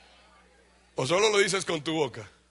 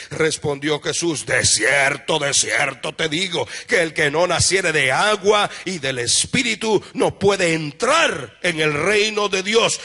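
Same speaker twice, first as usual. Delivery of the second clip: solemn preached reading; 8 dB louder, slower than usual. Acted. Reads John 3 with rising force.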